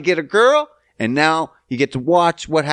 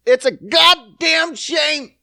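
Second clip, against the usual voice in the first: high, slow, drawn-out shout; hammed-up slow yell; mock outraged shout; 11 semitones higher, 3 dB louder, much slower than usual. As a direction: bellow it like a wronged preacher. Repeats line as outburst.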